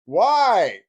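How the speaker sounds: loud, high questioning yell; retold demanding shout; calling out. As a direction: holler the question across the house.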